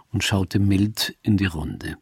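plain reading voice

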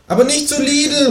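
very high kangaroo shout-out; kangaroo calling after. Acted. Kangaroo warns the departing shopper, calling very high. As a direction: call it after them, pitched right up. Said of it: "ruft mir das Känguru hinterher".

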